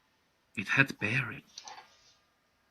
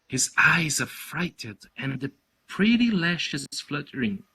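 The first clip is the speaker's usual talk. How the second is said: usual speaking voice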